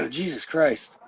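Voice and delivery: usual speaking voice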